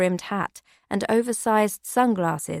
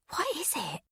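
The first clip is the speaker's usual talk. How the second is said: much softer, higher hushed question; whisper; 8 semitones higher, 10 dB quieter than usual. A narrator's reading. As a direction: ask it under your breath, uneasy. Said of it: "whispered Becky".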